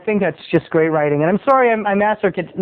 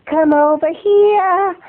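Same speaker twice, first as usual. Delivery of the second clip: high, slow Southern drawl; Southern lady; 10 semitones higher, 4 dB louder, much slower than usual. Acted. Southern neighbor summons boy, Southern drawl. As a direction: beckon sweetly, stretching it Southern-style. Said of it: "Southern hospitality".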